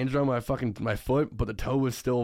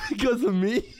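real laughing; 11 semitones higher than usual. Real, not acted. Friend admitting his school's steel toe mandate is his fault, laughing. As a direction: talk breaking into laughter, higher pitched.

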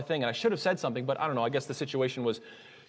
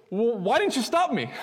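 high, loud, drawn-out accusation; acted-out indignant outburst; mad, blaming; 9 semitones higher, 5 dB louder than usual. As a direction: throw the blame back, indignant. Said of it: "he was just so mad at himself and mad at me".

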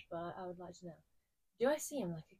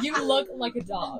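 louder, laughter-filled voice; teasing through laughter; 3 semitones higher, 7 dB louder than usual. Real, not acted. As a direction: say it while cracking up. Laughing as she teases friend.

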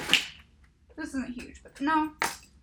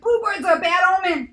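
louder, higher acted retort; retold tavern lady; 4 semitones higher, 7 dB louder than usual. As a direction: act out the tavern lady's pronouncement. Acts out the tavern lady's reply.

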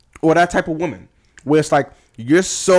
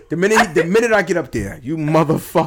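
mock vengeful villain; 9 semitones higher, 4 dB louder than usual. Acting out vengeful man at gates.

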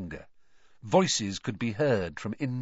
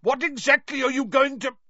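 high, loud demand, cut short; bellow; 5 dB louder than usual. A narrator's reading. Uncle Vernon bellowing at the fire, cut off mid-demand. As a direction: shout it until interrupted. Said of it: "he bellowed at the fire".

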